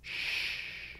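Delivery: breathy hushed "Shh"; whispered shush. Retold or imitated. Mom quoting the shushing daughter, whispered. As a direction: hush them like a secret.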